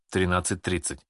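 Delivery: ordinary delivery, nothing marked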